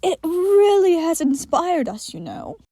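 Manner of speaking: sinister creature pleased with itself; creepy entity. Acted. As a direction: sound delighted and inhuman.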